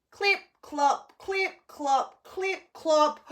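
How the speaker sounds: loud, high hoof-beat chant; clip clop chant. Acted. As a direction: stamp out the hoof sounds. Hoof sounds acted out.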